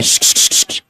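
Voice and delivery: sharp, voiceless shh sounds; repeated shushing. Real, not acted. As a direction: shush a noisy room in short bursts. Shushing a noisy audience. (श्श-श्श करके चुप कराते हुए)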